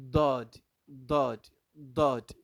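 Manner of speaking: "Dod" loud, with a heavy DH-like D; emphatic "Dod", unlike plain D; Arabic Daad demo. Shown as a demonstration. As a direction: punch each "Dod", tongue sides on the teeth. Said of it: "it's different from Dod".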